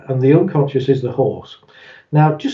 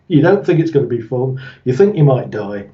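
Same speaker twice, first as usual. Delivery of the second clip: usual speaking voice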